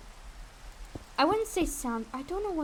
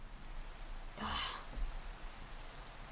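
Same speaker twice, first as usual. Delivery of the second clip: heavy sigh; 13 dB quieter than usual. Real, not acted. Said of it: "I'm gonna drink some water".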